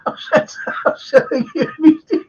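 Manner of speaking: high, breaking up with laughter; laughing. Real, not acted. Host telling meeting story, laughing high. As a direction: laugh helplessly through the words.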